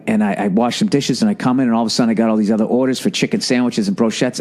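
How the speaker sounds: normal talking voice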